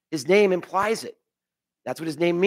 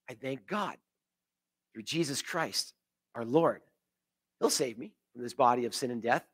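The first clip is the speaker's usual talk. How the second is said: hushed and much softer, lower thanks; quiet thankful revelation; 5 semitones lower, 9 dB quieter than usual. Retold or imitated. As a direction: breathe it out in grateful relief. Paul's revelation, voiced softly.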